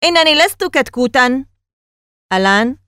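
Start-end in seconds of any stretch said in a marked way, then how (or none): 0.0-1.5 high, loud furious denial; Danny angrily shouts that he is no chicken; angry shout. A narrator's reading. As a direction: shout it back, stung. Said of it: "صرخ داني بغضب".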